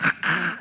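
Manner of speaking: imitated choking gurgle; strangled choking sound, acted out; choking noise. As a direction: make the sound of being choked. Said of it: "he was really choking him out".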